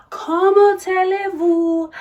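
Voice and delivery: mock French diva greeting. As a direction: repeat the hello even grander.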